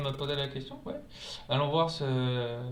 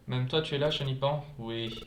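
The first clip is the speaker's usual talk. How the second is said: ordinary delivery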